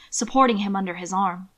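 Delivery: plain reading voice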